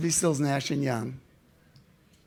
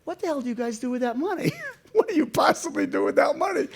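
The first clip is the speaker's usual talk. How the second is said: high, loud, amazed questioning; laughing disbelief, voice shooting up; hammed-up astonished shout; incredulous; 12 semitones higher, 4 dB louder, faster than usual. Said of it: "And I wanted to know".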